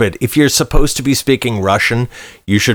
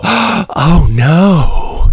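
gasping breathy "oh no", a bit higher; mock dumb woman; 5 semitones higher, 3 dB louder than usual. Mocking a dim moviegoer gasping at the screen.

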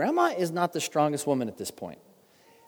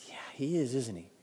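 grandma breaking; 3 semitones lower, 9 dB quieter than usual. Retold or imitated. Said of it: "Grandma just kinda broke".

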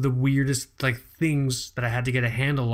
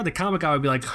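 usual speaking voice

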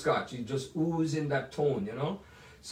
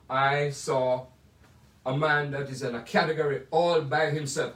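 usual speaking voice

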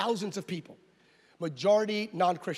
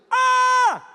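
high, loud imitated yell; drawn-out wordless shout; old man's yell; 10 dB louder than usual. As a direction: yell one long, high "ah". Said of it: "the guy starts yelling".

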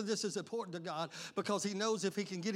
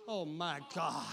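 normal talking voice

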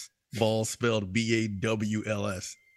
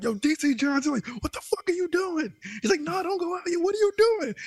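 much higher, yelling teammate bossing; mock frantic yell. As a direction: ham up a panicked squad leader. Imitating Jay yelling at teammate.

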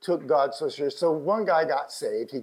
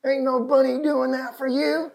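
Texas accent; 10 semitones higher, 5 dB louder than usual. Real, not acted. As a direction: preach it in folksy Texas speech. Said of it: "That's my Texas".

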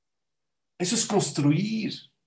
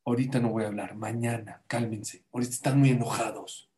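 low, softened, composed reply; calm them down in a lowered voice; Moshe; 5 semitones lower, 4 dB quieter than usual. Acted. Moshe puts off talking to angry rebels, calm and low. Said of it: "¿Saben qué le dijo Moshe?".

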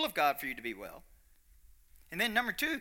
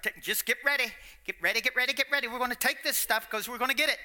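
mock urgent flu prep; 6 semitones higher, 4 dB louder, faster than usual. Mocking the church's flu-season talk.

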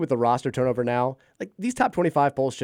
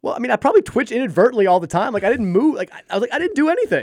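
incredulous protest; 11 semitones higher, 6 dB louder than usual. Retold or imitated. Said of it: "I'm like".

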